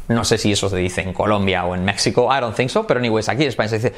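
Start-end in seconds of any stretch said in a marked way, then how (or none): none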